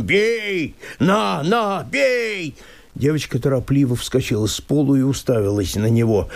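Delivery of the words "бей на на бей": высокий свирепый вызов; бросай «бей» как вызов; свирепо. Acted. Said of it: "свирепым голосом". (мужик свирепо подставляется под удар)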